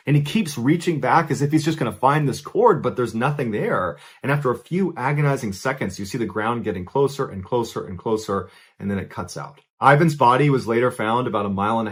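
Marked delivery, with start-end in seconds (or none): none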